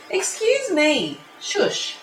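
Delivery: high, drawn-out telling-off; scolding. Real, not acted. Vlogger interrupts herself to tell off her cats, scolding. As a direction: call out a high-pitched reprimand, then shush.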